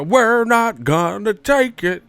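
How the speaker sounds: high, loud, slow protest chant; mock protest. Critics protesting Wilder, high loud mock chant. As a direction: ham up an angry mob.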